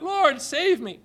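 high, loud desperate plea; cry for help. Acted. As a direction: Peter crying out as he sinks. Peter sinking, cries out.

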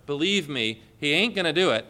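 ordinary talk

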